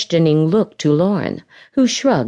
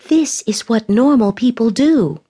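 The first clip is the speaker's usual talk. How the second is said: angry; 6 semitones higher than usual. A narrator's reading. Lauren angrily defends herself.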